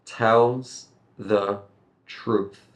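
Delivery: Tells... the... truth, carefully separated; slow, word by word. Shown as a demonstration. Teacher drills a hard phrase, slow separated words. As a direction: model each word with a pause before truth. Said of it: "It's kind of hard".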